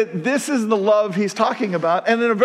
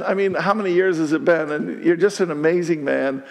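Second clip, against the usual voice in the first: ordinary talk, nothing marked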